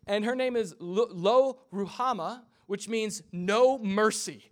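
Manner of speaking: ordinary talk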